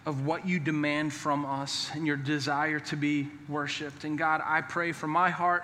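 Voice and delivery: closing prayer. Acted. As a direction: pray quietly over the congregation. Pastor leads closing prayer.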